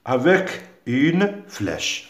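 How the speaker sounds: slow and deliberate, word by word; slow dictation. Shown as a demonstration. Teacher to pupils giving the instruction, slow dictation. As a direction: spell out the instruction slowly. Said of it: "relie ce qui va ensemble".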